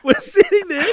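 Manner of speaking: high, loud words broken by laughter; can't get it out for laughing. Real, not acted. Laughing through the punchline.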